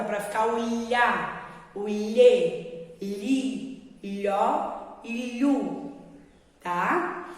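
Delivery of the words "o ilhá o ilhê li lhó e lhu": very slow "ilhê, li, lhó e lhu", tongue drawn back; slow teacher demo of lh; lh syllables, slow. Shown as a demonstration. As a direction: drag out every "lhu" syllable. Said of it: "Ela vem na frente, mas volta".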